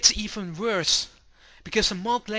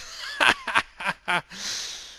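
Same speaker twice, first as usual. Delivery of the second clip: mocking laugh; 3 semitones higher than usual. Crowd laughing.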